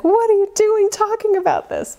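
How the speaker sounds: squeaky high alarmed inner self; startled inner self squeaking at her own boldness. Retold or imitated. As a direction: voice your panicking human side, high and shrill. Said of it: "a part of me as Human [name] that's like".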